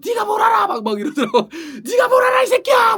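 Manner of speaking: high shrieking yell; mock screaming quote. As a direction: shriek it like a hysterical woman. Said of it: "울고 소리 지르고". (악쓰며 소리 지르는 흉내)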